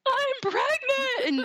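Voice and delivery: very high, drawn-out retold wail; mock bawling. Wife breaks the news to husband, mock bawling. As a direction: wail it slowly, hamming up the tears. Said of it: "bawling, and I'm like".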